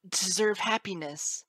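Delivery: high, loud defiant colt; impassioned outburst. A narrator's reading. Colt standing up to his father, high impassioned outburst. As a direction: declare it loudly in a high young voice. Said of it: "echo of his divine outburst".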